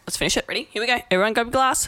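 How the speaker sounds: ordinary talk, nothing marked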